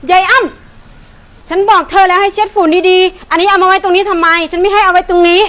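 loud, slow scolding; angry scolding. Acted. Shop owner scolding her helper about the dust, loud slow scolding. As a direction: tear into her, word by word.

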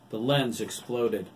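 plain reading voice

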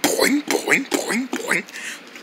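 soft "boing" sound effects; bouncing noise. Acted.

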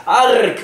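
short high hammed-up death cry; mock death groan. Old Attinghausen dies mid-scene, hammed-up death cry. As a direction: gag out a comic dying arg. Dying gasp.

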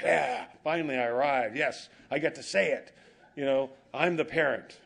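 mock-triumphant, finally getting to say it; hammed-up glee of a new parent. Parent gleeful at finally saying it.

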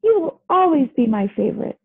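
put-on high, earnest little kid; lightly voiced adoring child; child's voice. A narrator's reading. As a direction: open high on the name, declare devotion. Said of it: "the child said".

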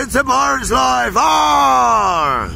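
shouted pirate arr; pirate growl. Pretend. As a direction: bellow it like a pirate captain. Pirate exclamation.

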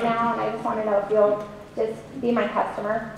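low, soft hesitant mumble; mock timid ask. Shown as a demonstration. Coach mocking a shy sales call, timid. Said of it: "Are you saying".

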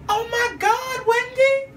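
squealing in delight; thrilled squeal. Retold or imitated. Overjoyed when Wendy asks him to stay. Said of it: "I was like".

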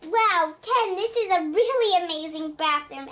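ordinary talk, nothing marked

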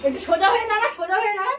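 calling out. Real, not acted. Telling boy to stand up.

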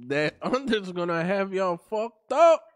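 ordinary delivery

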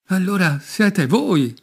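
loud, slow, high cry of recognition; the king cries out, finding his lost wife; joyful cry. Acted. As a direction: call it out as the truth lands. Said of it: "gridò il re".

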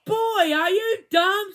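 high, loud and slow jeer; taunting child. A narrator's reading. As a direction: crow it out like a bratty girl. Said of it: "said Joy".

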